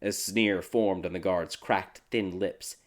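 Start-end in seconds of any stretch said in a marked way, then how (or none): none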